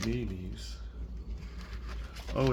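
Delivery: plain reading voice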